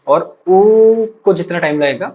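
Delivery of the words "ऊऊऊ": उ drawn out very long; prolonged vowel. Shown as a demonstration. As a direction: hold उ as an extended oooo. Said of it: "प्लुत को लगेगा".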